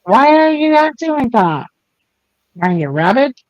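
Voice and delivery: pet talk. Real, not acted. Talking to her pet.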